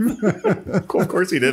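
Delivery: laughing. Real, not acted. Laughing reaction.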